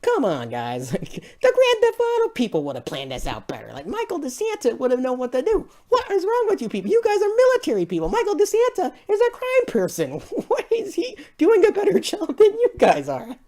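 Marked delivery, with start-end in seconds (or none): 3.9-13.4 much higher, strained disbelief; high squeal of mock outrage at the soldiers; incredulous rant. Ranting at the movie characters.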